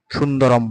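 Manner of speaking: সুন্দরম ending on a clear "m"; final M sounded. Shown as a demonstration. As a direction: close the lips on the last sound of সুন্দরম. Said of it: "সুন্দরমের লাস্টে যেটা ওর অনুস্বার". (সুন্দরম-এর অনুস্বার ম হিসেবে)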